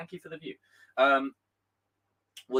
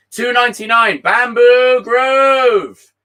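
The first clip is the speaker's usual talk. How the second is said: drawn out loud and high, slowly; excited donation shout-out; 11 semitones higher, 14 dB louder, much slower than usual. Real, not acted. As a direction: call the donor's name out big and stretched.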